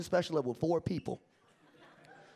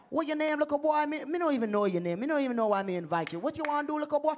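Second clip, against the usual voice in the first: belittling Jamaican patois; Jamaican accent; 6 semitones higher, faster than usual. Retold or imitated. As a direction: challenge a newcomer like a Jamaican host. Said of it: "messing with some Jamaicans".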